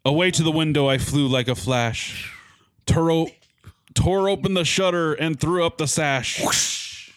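reading a Christmas poem with drama; dramatic poem reading. Acted. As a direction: recite it like a storyteller. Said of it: "like, dramatic".

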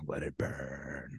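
slow, soft let it burn; mock sinister murmur. Voicing the inner Russian girl, slow and soft. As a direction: savor it quietly and slowly. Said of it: "the little Russian girl".

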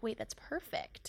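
slow hushed whisper. Retold or imitated. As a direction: breathe it out slowly, no voice. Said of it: "and she's like".

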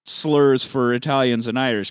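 usual speaking voice

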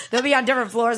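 ordinary delivery

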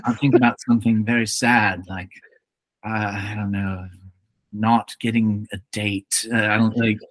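lightly voiced actor straining to feel sad, quick then trailing; mock brooding actor. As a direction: play a hint of a mopey actor summoning tears. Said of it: "I could just see Jude Law".

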